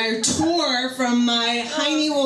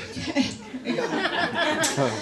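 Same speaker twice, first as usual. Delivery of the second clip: slow, drawn-out psyching up; a hint of bracing herself; mock psyched-up; 5 semitones higher, 3 dB quieter, much slower than usual. Woman psyching herself up before walking in, drawn out. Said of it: "I was like".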